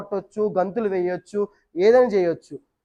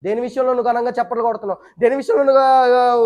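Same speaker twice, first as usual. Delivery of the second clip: usual speaking voice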